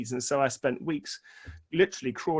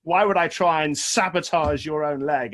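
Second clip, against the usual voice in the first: insistent protest; 3 semitones higher, 6 dB louder than usual. Retold or imitated. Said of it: "He said".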